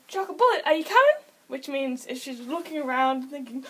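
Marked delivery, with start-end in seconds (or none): 0.1-1.2 high, loud coaxing question; high, loud pet voice; pet command call. Shown as a demonstration. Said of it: "I do".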